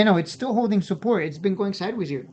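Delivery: normal talking voice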